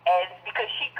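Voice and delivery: normal talking voice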